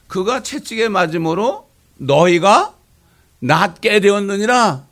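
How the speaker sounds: slow, loud verse reading; slow scripture recital. Acted. Pastor repeating the familiar verse, slow and solemn. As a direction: weigh every word of the verse.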